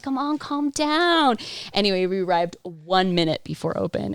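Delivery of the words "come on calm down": high, slow, drawn-out mock pleading; retold plea. Passenger to honking, mad driver, exasperated mock plea. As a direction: stretch it out, begging the driver to settle.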